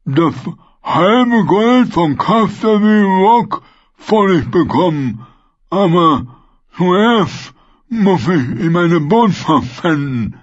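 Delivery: sehr hoch, laut und langsam gedehnt; Vargo Hoth. Acted. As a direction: jedes Wort genüsslich und sabbernd ziehen. Vargo Hoth spricht weiter. (der Söldnerführer freut sich über den Fang, hoch und gedehnt)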